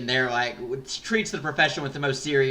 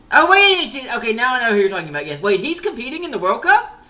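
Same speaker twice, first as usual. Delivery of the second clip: sudden rush of recognition, higher, louder and fast; excited surprise; 11 semitones higher, 10 dB louder than usual. Real, not acted. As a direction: blurt it out as it clicks, tumbling fast. Suddenly recognizing who is meant.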